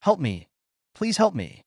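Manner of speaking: understated cry for help, pitch jumping on the plea; mild yell for help. A narrator's reading. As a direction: narrate a woman's plea with restrained urgency. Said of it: "they heard a woman yelling".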